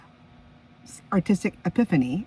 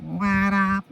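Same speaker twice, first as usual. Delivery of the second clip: nasal voice; 3 semitones higher than usual. Retold or imitated. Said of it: "that unmistakable nasal voice".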